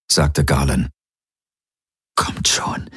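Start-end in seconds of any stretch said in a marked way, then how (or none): 2.1-2.9 voiceless, softer urging; whisper. A narrator's reading. Kirai urges the aliens on, whispering. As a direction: whisper it under your breath. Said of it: "hörte er Kirai flüstern".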